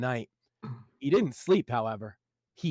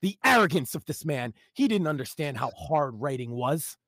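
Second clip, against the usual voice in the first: normal talking voice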